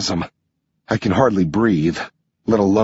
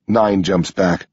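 ordinary delivery, nothing marked

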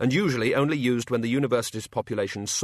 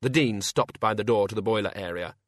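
plain reading voice

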